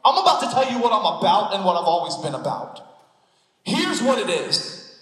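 rising passionate pulpit delivery; full-force preaching. Acted. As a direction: preach it out to the whole room.

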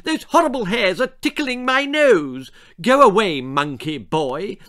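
put-on high, loud haughty girl scolding; spoilt girl. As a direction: look down your nose and shriek at him.

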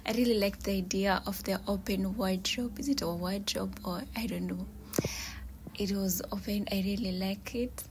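soft whisper all through, with a breathy laugh here and there; whispered room tour. Real, not acted. Showing viewers her shoes and clothes, in a soft whisper.

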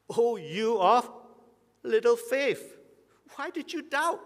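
high, loud, incredulous rebuke; high rebuking Jesus. Retold or imitated. Jesus to a sinking Peter, a high loud rebuke. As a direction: chide him for doubting, voice up high. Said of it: "Jesus' words to him".

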